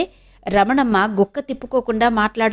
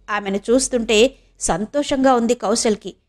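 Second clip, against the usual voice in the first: ordinary delivery, nothing marked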